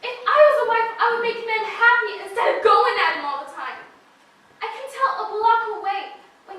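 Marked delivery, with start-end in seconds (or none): 0.0-3.8 loud, higher, defiant; defiant, louder rant; heated outburst. Acted. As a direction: pour it out in a rush.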